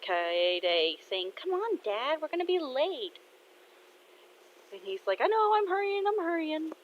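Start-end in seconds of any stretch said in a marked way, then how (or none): 1.3-3.2 put-on high-pitched kid hurrying her father; daughter urging dad. 5.2-6.7 high, harried protest; flustered dad. Acted. Flustered dad answering hurrying daughter.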